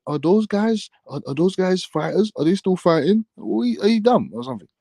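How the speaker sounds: high-pitched mimicry of dumb questions; mocking imitation. Repeating a rival's questions back, mocking imitation.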